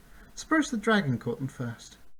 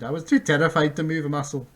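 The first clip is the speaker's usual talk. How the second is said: calling back; 4 semitones higher, 7 dB louder than usual. Acted. Bink replies while fleeing.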